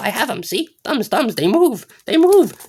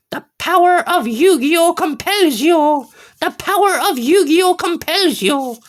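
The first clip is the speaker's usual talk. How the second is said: mock proclamation; 10 semitones higher, 4 dB louder than usual.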